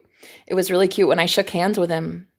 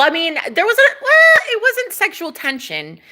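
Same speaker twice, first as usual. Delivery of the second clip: laughing; 11 semitones higher, 4 dB louder than usual. Real, not acted. Laughing at own words.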